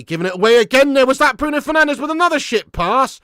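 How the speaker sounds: angry rant. Real, not acted. Fan rants at giveaway.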